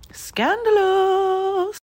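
high drawn-out whisper; mock scandalized. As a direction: gasp it out, slow and scandalized. Mock gasp after warning.

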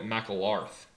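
McEllarth said as MACK-ul-arth; misspelled surname. Shown as a demonstration. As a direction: end McEllarth in "arth", A before R. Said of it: "mixed up the A and the R".

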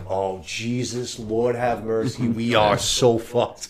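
drawn-out, quiet dread, slowed right down; slow despair. Retold or imitated. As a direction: sigh it out slowly like it's all lost. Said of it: "it's just like".

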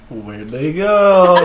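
high, drawn-out puzzled call; mock bewilderment. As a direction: stretch it out loudly, like a baffled onlooker. Acted bystander after the teleport gag.

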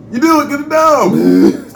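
exasperated shout. Real, not acted. Ranting at bad drivers.

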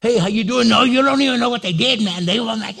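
breathless busybody retelling a grievance; compulsive story rehearser. Acted. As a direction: gabble excitedly without taking a breath. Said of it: "that's all they ever talk about".

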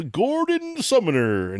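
high, long-drawn name; mock full-name scolding. Host playfully calling out [name], mock scolding. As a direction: stretch the name out high and slow.

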